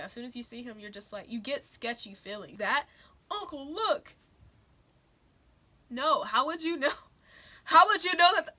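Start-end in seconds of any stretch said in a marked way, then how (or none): 3.3-4.1 higher-pitched mock "Uncle, look"; mock movie quote. Reviewer quoting the film's abrupt line, mocking. 5.9-8.5 loud, high, exasperated outburst; upset. Real, not acted. Reviewer objecting to the film's storytelling, upset. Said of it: "I'm already so upset".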